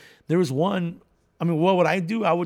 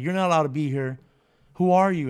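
raised voice, imitating a guard; acted-out challenge, getting louder; stern guard; 5 dB louder than usual. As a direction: order off an intruder, mock-stern. Guard rebukes intruder in retold story.

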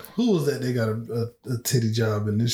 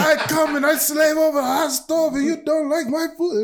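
much higher, put-on Italian accent, wounded chef. Restaurant owner scolding Justin's date, hammed-up Italian accent. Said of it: "he pulls out that fucking Italian accent".

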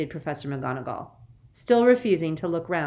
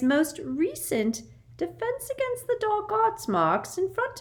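put-on simpering high, girlish woman; sickly sweet and very high; sugary high voice; 13 semitones higher, 4 dB louder than usual. A narrator's reading. Umbridge's voice in the story.

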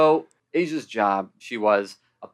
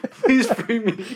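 mock audition plea; 10 semitones higher, much slower than usual. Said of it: "You say that in an audition".

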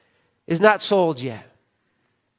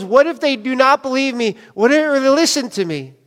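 raised-pitch imitation of Moses objecting; higher, urgent mock worry; emphatic mock protest; 6 semitones higher than usual. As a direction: retell his objection, pitched up and insistent. Repeats Moses' objection with force.

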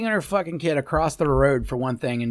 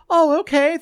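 mock reply; 13 semitones higher, 4 dB louder than usual. Imagined sarcastic passenger reply.

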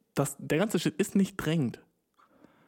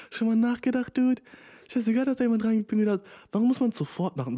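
excited rant; 9 semitones higher than usual. Real, not acted. Ranting about bed.

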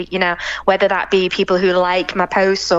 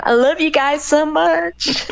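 slow, higher and tender, a real heartfelt thank-you; 6 semitones higher, much slower than usual. Real, not acted. Model thanking her supporters on a podcast, slow heartfelt tenderness. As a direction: let the love for your fans pour out slowly.

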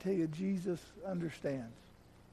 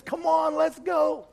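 high, louder, eager invitation; much higher and louder, joyful urging; eager call; 11 semitones higher, 10 dB louder, slower than usual. Retold or imitated. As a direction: beckon someone to come along now. Dad invites son to follow.

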